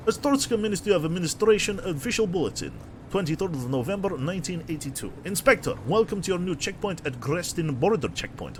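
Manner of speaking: hammy mock-Russian official; bad Russian accent. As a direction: read the notice in a badly faked Russian accent. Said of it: "doing a bad Russian accent".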